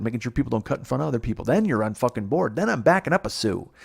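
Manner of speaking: high-pitched, indignant tirade; exasperated rant. Real, not acted. Man ranting over being told he can't cross his legs, exasperated. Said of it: "drives me fucking crazy".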